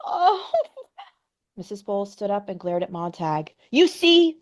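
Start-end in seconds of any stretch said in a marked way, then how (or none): 0.0-0.5 high, louder tearful wail; falling apart in sobs; sobbing. Acted. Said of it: "sobbed Missus Phelps". 3.7-4.4 high, louder triumphant accusation; accusing. Acted. Glaring accusation at Montag.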